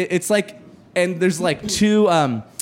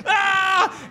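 mob battle yell; 13 semitones higher than usual. Retold or imitated. Crowd throwing swag, high yell. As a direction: charge in yelling.

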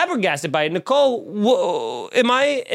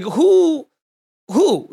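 ordinary delivery

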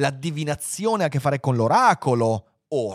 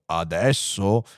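low, slow question; grave computer; 5 semitones lower, much slower than usual. Acted. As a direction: query it with ponderous calm. Computer questions the urgent demand. (domanda grave e lenta)

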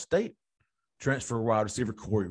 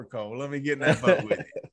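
normal talking voice

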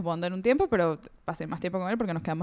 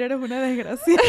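laughing mock insult; 3 semitones higher, 3 dB louder than usual. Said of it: "Pensé que ibas a decir".

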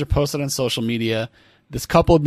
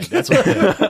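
laughing; 10 semitones higher, 4 dB louder than usual. Real, not acted. Laughing recognition.

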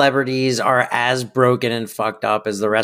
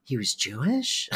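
hushed, gasping mock disbelief, much softer; a fake scandalized gasp, half whispered; mock shocked gasp; 11 dB quieter than usual. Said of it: "It's always like".

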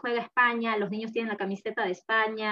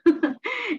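laugh; 11 semitones higher, 6 dB louder than usual. Real, not acted. Laughs about husband's accent.

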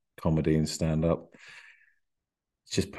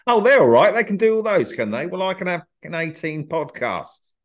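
high, louder mocking voice; mock scoff; 9 semitones higher, 4 dB louder than usual. Imitating snide viewers mocking the podcaster. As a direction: sneer at the panel-show regulars. Mocking how others see him.

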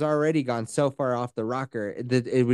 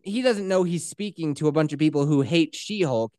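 ordinary delivery

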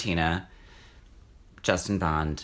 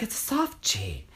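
Dina Martina voice; 5 semitones higher, 5 dB quieter than usual. Retold or imitated. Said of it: "she's like".

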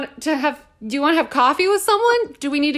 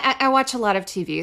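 normal talking voice

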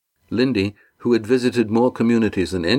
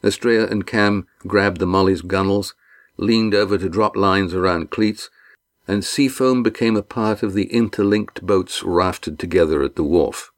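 ordinary delivery

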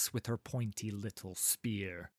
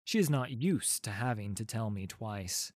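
usual speaking voice

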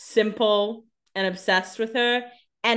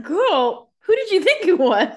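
put-on high, loud sassy girl voice; sassy retort; 9 semitones higher, 4 dB louder than usual. Co-host sassing a character for being clueless, loud and high. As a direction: give it full sass, up high.

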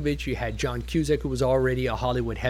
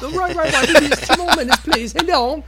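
put-on louder British accent pitched way up, eager; a chirpy London crew chief hurrying the shoot along; mock British director; 13 semitones higher, 9 dB louder than usual. British director rushing the crew, mocked in a much higher, louder British accent. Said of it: "your typical British metro male kind of guys".

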